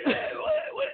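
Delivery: high-pitched mock alarm; baffled reaction. Caller acting out his confusion at the accent, baffled. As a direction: leap up high as if stopping someone.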